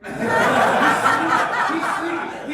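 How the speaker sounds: cracking up mid-word; laughing. Real, not acted. Teasing the sleepy uncle, laughing hard.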